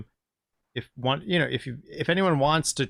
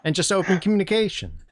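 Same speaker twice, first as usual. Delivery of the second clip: fast, high hollow skeleton chatter; a friendly but eerie bone voice; 8 semitones higher, 4 dB louder, much faster than usual. Acted. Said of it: "in such a friendly way".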